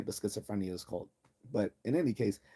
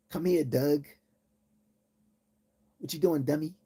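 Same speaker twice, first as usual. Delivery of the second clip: mock Baltimore accent; 5 semitones higher than usual. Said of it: "the Baltimore accent sounds like".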